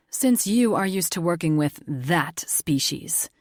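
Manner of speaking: "that species" spat out slow and hushed with distaste; bitter stress on "that species". A narrator's reading. Richter sneering at the Ameraki, stress on "that species". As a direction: sneer the words "that species" as if they taste bitter. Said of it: "The way he emphasized the two words".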